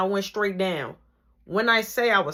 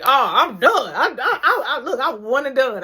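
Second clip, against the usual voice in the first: laughing quit; 6 semitones higher, 7 dB louder than usual. Retold or imitated. Laughing quitting line.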